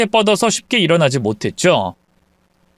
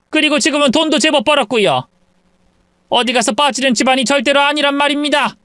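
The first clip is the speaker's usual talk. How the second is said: higher, outraged protest; indignant mother; 9 semitones higher than usual. Acted. Mother protests to the in-law, indignant. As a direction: stand up for your family.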